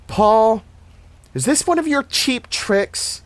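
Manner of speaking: high-pitched challenge into the dark; calling out suspiciously. A narrator's reading. Annie calls to Paul in the dark, high challenging call. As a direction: call out high, accusing a prankster. Calling to suspected prankster outside.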